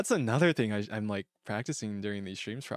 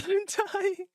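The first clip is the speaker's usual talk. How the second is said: laughing; 5 dB louder than usual. Real, not acted. Laughing hard at a joke.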